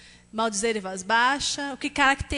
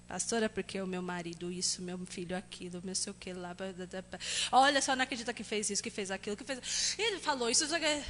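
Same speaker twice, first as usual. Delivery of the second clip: muttered, soft grumbling quote; mock complaining churchgoer; 5 semitones lower, 8 dB quieter, faster than usual. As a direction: imitate someone whining to the pastor. Complainer voiced to pastor. (imitando uma pessoa queixosa, baixinho)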